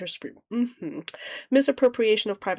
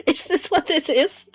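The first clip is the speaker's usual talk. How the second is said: laughing; 8 semitones higher, 4 dB louder than usual. Real, not acted. Laughing disbelief at the document.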